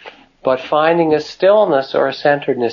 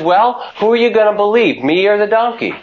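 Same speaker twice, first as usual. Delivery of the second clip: indignant retort; 7 semitones higher than usual. Retold or imitated. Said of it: "looks back equally indignantly".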